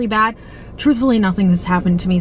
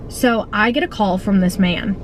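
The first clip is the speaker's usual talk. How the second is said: normal talking voice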